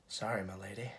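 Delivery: low, quiet, creepy murmur of a stalker; a hushed, creepy bow of a line; creepy Anakin. Retold or imitated. Anakin creeping on Padme as she walks off, low and hushed. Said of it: "And he looks so creepy".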